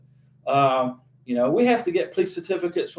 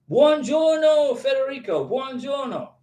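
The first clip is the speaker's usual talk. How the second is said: Italian greeting; 5 semitones higher than usual. Shown as a demonstration. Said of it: "Now I'm trying to remember my Italian".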